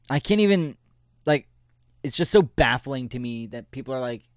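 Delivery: ordinary talk, nothing marked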